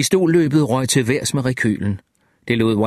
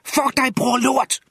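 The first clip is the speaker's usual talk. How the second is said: high-pitched screamed abuse; screamed curses; 13 semitones higher, slower than usual. A narrator's reading. Jack keeps cursing the shot alligator, high-pitched screaming. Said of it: "skreg Jack".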